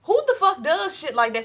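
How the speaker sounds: high, loud outraged disbelief; loud, high who-does-that outrage. Retold or imitated. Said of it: "And I'm just like".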